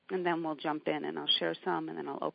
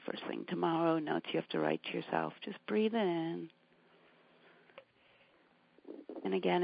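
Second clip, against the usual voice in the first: calm grounding exercise. Acted. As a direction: gently talk them into their breath. Meditation continues.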